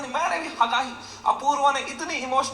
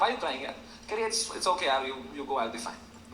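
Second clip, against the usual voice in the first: teary, fading out; 9 semitones lower, 7 dB quieter, slower than usual. Retold or imitated. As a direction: voice sinking to almost nothing, mock tearful. Crying guest quoted.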